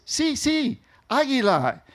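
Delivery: high, emphatic "Sí, sí, águila"; correcting him with eager insistence; eager correction. Retold or imitated. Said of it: "And he says".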